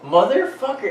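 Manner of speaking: high, amazed is that motherfucker; surprised disbelief. Retold or imitated. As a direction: squeal in disbelief at who it is. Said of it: "I was like".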